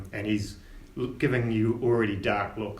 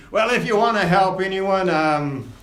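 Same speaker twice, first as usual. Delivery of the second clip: gruff first mate; 5 semitones higher, 6 dB louder, slower than usual. Acted. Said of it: "Scabbers says".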